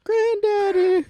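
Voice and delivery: high, slow squeaky call; mock grandchild. Grandchild calling to shooting granddaddy, high mock voice. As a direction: draw it out in a high kid voice.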